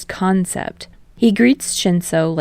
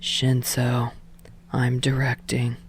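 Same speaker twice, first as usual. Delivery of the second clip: low, soft and certain; calm deep self-introduction; low sure voice; 5 semitones lower, 7 dB quieter, slower than usual. A narrator's reading. Shinso introduces himself.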